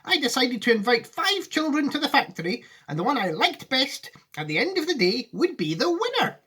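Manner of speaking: high, earnest Wonka voice; serious Wonka. Acted. Wonka answering Charlie seriously, in a high voice.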